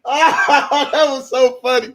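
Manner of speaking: real laughter through the words, a bit slow; helpless with laughter over the letter; laughing. Real, not acted. Streamer laughing at R. Kelly's letter to viewers, laughing.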